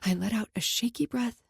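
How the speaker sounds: ordinary delivery, nothing marked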